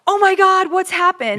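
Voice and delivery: high, loud panicked gasp of a mother; imitating a mom shocked to see her kid fall; mock alarmed mom. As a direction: play a mother alarmed at the window. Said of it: "she looked like".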